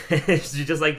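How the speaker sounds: ordinary talk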